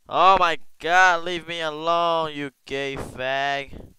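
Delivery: furious outburst at an attacker; angry yell. Real, not acted. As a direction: yell at the guy who won't leave you alone. Angry at player attacking him.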